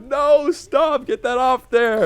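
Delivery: high, drawn-out mock panic; Thomas freaked out. Friend freaked out by a video in chat, mock panic. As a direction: imitate someone begging for it gone. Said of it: "he was freaked out by it".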